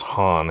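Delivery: "Han" said with the flat a of "hand"; "Han" rhymed with "can"; mispronounced Han. Retold or imitated. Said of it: "He mispronounces Han the same way".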